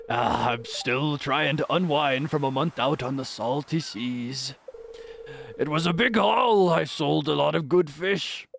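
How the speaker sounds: put-on slow, drawn-out old seafarer; Willy Wilson's voice. Fisherman telling of a month on salty seas, slow seafarer voice. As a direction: unwind after a long haul at sea. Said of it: "give Willy Wilson's voice".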